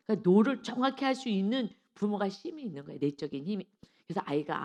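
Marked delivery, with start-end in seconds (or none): none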